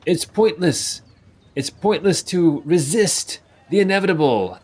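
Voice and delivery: ominous preaching cry; doomsayer. Acted. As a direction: proclaim doom to passersby. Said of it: "And you hear him saying".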